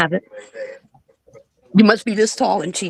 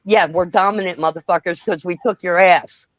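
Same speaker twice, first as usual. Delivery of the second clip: normal talking voice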